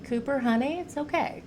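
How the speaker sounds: soothing the dog. Real, not acted. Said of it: "the way I talk to my dog".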